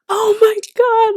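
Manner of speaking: high, loud, slow squeal; excited squeal. Retold or imitated. Two fans freaking out together, loud squeal. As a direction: squeal while jumping. Both jumping up and down.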